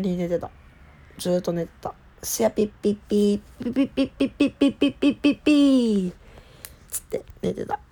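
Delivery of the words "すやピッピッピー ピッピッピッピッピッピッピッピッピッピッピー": sleepy snore sound. Retold or imitated. Imitating sleep noises.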